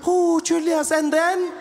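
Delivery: high, wailing mother voice; hammed-up motherly dismay; shocked mom. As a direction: fuss over a too-skinny child. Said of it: "I felt like a mom".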